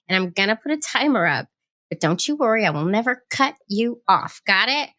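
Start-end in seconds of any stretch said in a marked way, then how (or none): none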